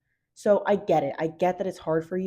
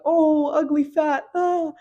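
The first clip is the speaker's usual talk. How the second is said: high, loud mock whiny wail; self-loathing whine; 9 semitones higher, 6 dB louder, slower than usual. A girl mocking her own panicked thoughts in a high loud whine. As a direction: wail it like a spiralling inner critic. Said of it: "when those thoughts come up and you're like".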